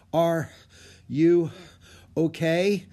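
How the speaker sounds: out of breath, word by word; panting. A narrator's reading. Winded girl asks guide after the climb, panting. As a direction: break it up with gaps, as if winded.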